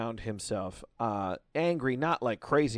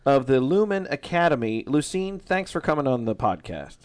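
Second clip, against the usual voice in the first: loud, higher-pitched show opener; podcast host introduction; 6 semitones higher, 8 dB louder than usual. Real, not acted. Host welcomes guest Lucene Drake onto the show, loud announcing introduction. Host opens recorded interview.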